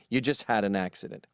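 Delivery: ordinary talk, nothing marked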